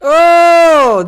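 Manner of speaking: excited shout. Real, not acted. Sudden recognition, shouted.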